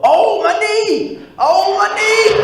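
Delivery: high, slow, loud pained yell; hammed-up wail over a hurt knee; brother yelling in pain. Brother fakes a knee injury, loud slow pained yell. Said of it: "I heard my brother yell".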